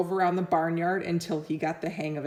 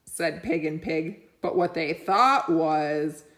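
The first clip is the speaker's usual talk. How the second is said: ordinary delivery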